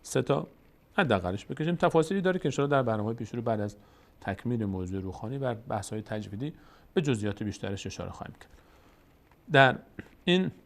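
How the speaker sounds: ordinary delivery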